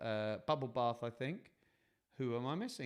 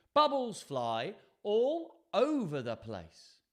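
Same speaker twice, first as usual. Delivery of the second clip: ordinary delivery, nothing marked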